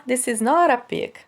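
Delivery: "not a" joined as "noɾ a" with a flapped T; linked consonant. Shown as a demonstration. Teacher demonstrating linking to students, joined sounds. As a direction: glide the T of "not" straight into "a". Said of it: "junta ali na pronúncia a consoante T com a próxima vogal".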